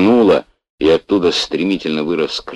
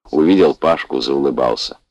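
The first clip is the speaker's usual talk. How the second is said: plain reading voice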